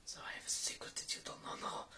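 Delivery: hushed, confidential whisper; secretive whisper. Pretend.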